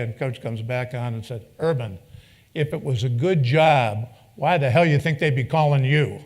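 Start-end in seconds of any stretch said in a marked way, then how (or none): 1.6-6.2 mock scolding. Holtz scolds Urban over the job, loud mock exasperation. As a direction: bark the punchline at the young coach.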